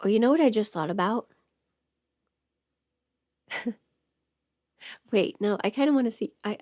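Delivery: ordinary talk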